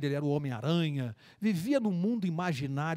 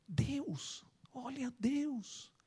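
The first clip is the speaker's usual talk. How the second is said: hushed, slow whisper of wonder; awed whisper; 7 semitones higher, 7 dB quieter, much slower than usual. Acted. Boy whispering in awe at the old man on the bench. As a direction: breathe it out in awe.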